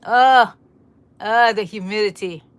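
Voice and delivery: loud groan at the muggy air; exasperated groan. Real, not acted. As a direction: complain loudly about the humidity. Groans about the humidity.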